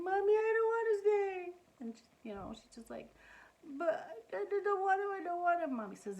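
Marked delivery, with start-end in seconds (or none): 0.0-1.5 put-on high, whiny little girl pleading; child begging mommy not to leave; crying child. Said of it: "She's crying". 3.8-5.7 put-on high, whimpering little girl; tearful child. Crying child at drop-off.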